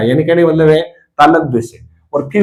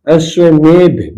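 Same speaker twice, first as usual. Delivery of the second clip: slow teaching; 2 semitones higher, 4 dB louder, much slower than usual. Shown as a demonstration. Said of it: "अश्वमेघ नहीं होता है".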